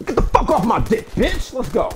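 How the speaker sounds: high furious screaming; angry yell. Real, not acted. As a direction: yell it out in rage. Furious at teammate mid-game.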